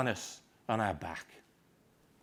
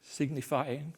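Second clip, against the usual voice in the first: slow, hushed, grieving soliloquy; Shakespeare recitation; slower than usual. Acted. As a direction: let each phrase fall into emptiness. Macbeth's tomorrow soliloquy recited.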